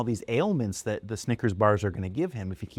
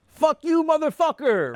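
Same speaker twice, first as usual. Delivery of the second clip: much higher, louder mock curse; imitating dad cursing; 7 dB louder than usual. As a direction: ham up dad's swearing. Imitating swearing immigrant dad.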